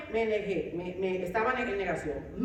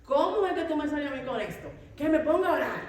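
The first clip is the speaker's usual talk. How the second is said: higher and louder, indignant at her husband; indignant retort; 6 semitones higher, 4 dB louder than usual. Retold or imitated. Wife angrily rejecting husband's advice to pray, raised voice. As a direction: snap back, offended. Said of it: "Yo me di una enojada".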